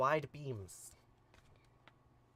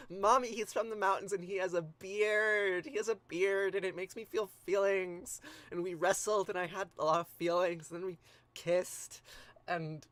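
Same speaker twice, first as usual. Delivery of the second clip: lovestruck son; 3 semitones higher, 5 dB louder than usual. Pretend. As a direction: gush to mom like a smitten teenager. Gilgamesh gushing to mom about Enkidu.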